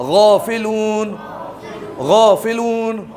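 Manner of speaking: غافلون slowly, the غ pressed from the upper throat; heavy throaty ghain. Shown as a demonstration. Teacher drilling the class on a gargled غ in غافلون. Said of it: "جیسے غرارے کرتے ہیں نا وہ آواز آئے گی".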